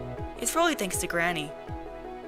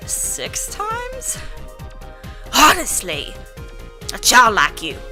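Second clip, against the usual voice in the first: foul mouthed granny; 2 semitones higher, much slower than usual. Acted. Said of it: "my grandma voice is really incongruent".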